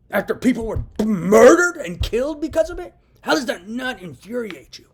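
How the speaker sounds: real angry shout at the listener; real anger. Real, not acted. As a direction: let the outrage boil over, shouting. Ranting about crimes against him.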